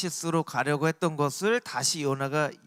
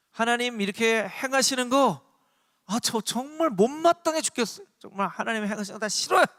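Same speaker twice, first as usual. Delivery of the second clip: higher-pitched acted grumbling; fed-up complaint; 6 semitones higher than usual. Preacher voices Jonah objecting to what God does, fed-up complaint. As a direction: gripe as if you can't stand it. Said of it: "매우 싫어했다는 거예요".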